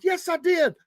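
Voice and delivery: high-pitched, imitated drunken insistence; insistent drunk friend. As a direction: play a tipsy friend swearing it's true. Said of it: "he's three sheets to the wind".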